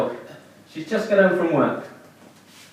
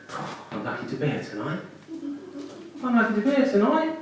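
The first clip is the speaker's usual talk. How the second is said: mocking comedians; 8 semitones higher, 5 dB quieter than usual. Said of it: "they'll often say".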